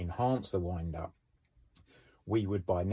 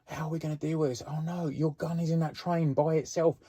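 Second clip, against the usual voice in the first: mock panic; 8 semitones higher than usual. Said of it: "everyone would be like".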